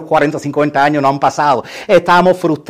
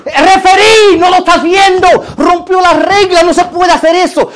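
high, loud shouting at the ref; fan yelling at TV; 11 semitones higher, 7 dB louder than usual. Retold or imitated. Fan yelling at referee on TV, loud high shout. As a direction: rant at the referee like a furious fan.

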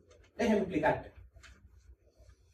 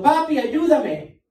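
put-on high, slow, louder cry of a child calling Papi; child crying for help; 7 semitones higher, 7 dB louder, much slower than usual. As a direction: call out like a frightened child. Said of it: "si oigo a mi hijo gritando".

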